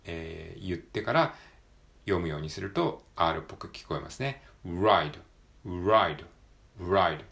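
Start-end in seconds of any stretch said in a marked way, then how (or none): 4.7-7.2 "ride" started with a small "u" glide before the r. Shown as a demonstration. Teacher drills "ride" with a small "u" sound first. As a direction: begin "ride" with a small "u", then roll the r. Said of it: "ちっちゃいうというのを最初に".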